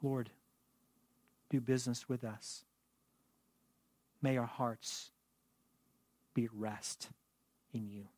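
soft, hushed prayer fading to almost nothing; quiet prayer. Acted. Pastor praying over the congregation, hushed and soft. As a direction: pray it gently and low.

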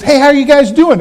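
bright-pitched, hearty greeting to strangers; gregarious dad. Retold or imitated. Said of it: "Kayleen's dad is anything but shy".

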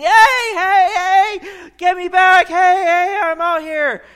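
high, loud desperate yelling; screaming for help. Retold or imitated. A man overboard shouting at his ship. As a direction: yell for the ship to come back. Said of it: "he's sitting there screaming".

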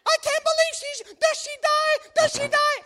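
husband imitating wife panicking at a thriller; wife's mock panic. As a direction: scream at the screen as the wife. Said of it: "Here goes Angie. She's like".

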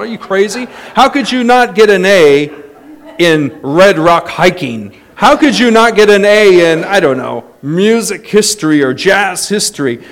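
loud, incredulous, mock scold. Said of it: "I would have slapped him and thought".

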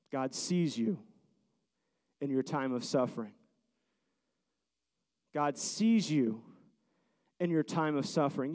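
a hushed, low promise to the suffering; soft pastoral assurance. Acted. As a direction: lean in and comfort the grieving listener. Soft intimate sermon refrain.

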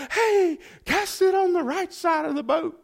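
high call from far off, pitched well up; called from the shore. Retold or imitated. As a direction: call it out across the water. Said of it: "this voice yells out from the shore".

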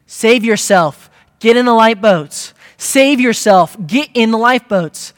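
captain yelling urgently over a sinking ship. Acted. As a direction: shout it like an order to abandon ship. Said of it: "he begins to yell and scream".

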